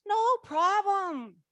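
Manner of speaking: high, slow, light mentor voice; mentor's breezy reassurance. Retold or imitated. The laughing mentor Betty brushes off the worry, breezy. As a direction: toss it off lightly, as the amused mentor. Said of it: "She goes".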